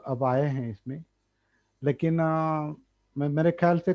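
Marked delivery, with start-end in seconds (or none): none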